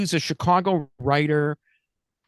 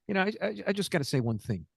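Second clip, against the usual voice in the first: normal talking voice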